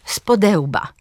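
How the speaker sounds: "z podełba" as spo-DE-łba; stress second syllable. Shown as a demonstration. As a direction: lean on DE in "z podełba". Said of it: "Akcent pada na drugą sylabę przyimka". („z podełba” z akcentem na DE)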